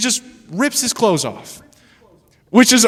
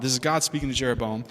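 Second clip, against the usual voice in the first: "This is God speaking to Jeroboam" said lower and softer; quiet restatement; 6 semitones lower, 8 dB quieter than usual. Shown as a demonstration. Preacher restating to the congregation, lower and softer. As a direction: repeat it lower and quieter to let it land. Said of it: "And this is God speaking".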